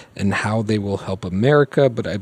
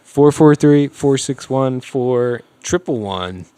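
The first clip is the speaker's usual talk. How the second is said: ordinary talk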